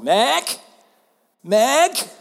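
sing-song call for Meg; imitating a child calling her mom. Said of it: "she was actually mocking me".

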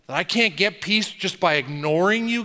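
ordinary delivery

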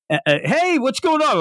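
high startled stammer, voice jumping up; flustered girl caught off guard, pitched high. Retold or imitated. Sister waking to stranger, startled high voice.

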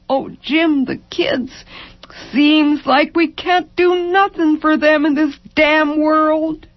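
high, keening lament; broken despair. A narrator's reading. Wife lamenting her children's future to husband, brokenly. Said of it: "Over and over, broken".

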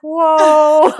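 high, loud drawn-out whoa; hammed-up astonishment; mock shocked mom. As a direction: imitate a stunned mother. Imitates mom's shocked reaction.

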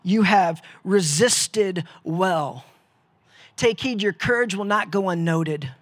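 Jesus honouring his tired fighters; measured proclamation; Jesus speaking. Acted. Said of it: "he looks at us and says".

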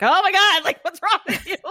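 high, loud, laughing disbelief; laughing high mock shock; shocked friend. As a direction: squeal it like a stunned friend. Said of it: "she was like".

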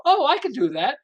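high, loud burst of discovery; light up with the idea; loud excited aha; eager realization. Retold or imitated. Voicing people seeing the pattern.

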